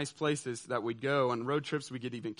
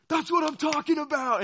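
much higher, loud hype holler; coach's victory shout; 12 semitones higher, 7 dB louder than usual. Retold or imitated. Coach yelling to the team after the win, loud and high. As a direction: fire up the whole bus like a coach. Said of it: "he goes".

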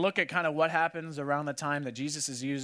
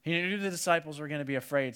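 ordinary talk, nothing marked

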